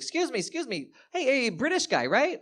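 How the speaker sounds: high-pitched imitation of someone calling after. Party guest chasing the British guy, calling out.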